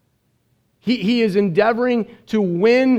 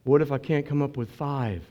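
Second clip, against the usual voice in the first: mock timid; 7 semitones lower, 7 dB quieter than usual. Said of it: "what he said to me".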